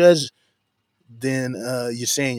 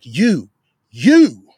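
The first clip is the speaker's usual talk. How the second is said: second YOU hit much louder; emphatic double you; 9 dB louder than usual. Retold or imitated. Preacher hammering at the church, emphatic you, you. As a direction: drive home the word you twice. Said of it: "He's emphatically saying".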